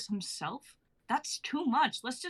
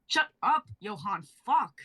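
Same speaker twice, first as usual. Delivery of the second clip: snapping angrily; 5 dB louder than usual. Acted. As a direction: bite his head off. Luca snapping at partner.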